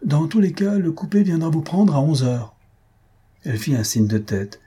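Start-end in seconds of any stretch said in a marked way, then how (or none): 0.0-2.5 high-pitched call without much volume. A narrator's reading. Husband calls to Antoinette as he passes the gate, calling out. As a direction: call back to someone from a departing carriage. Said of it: "il se retourna et cria à Antoinette".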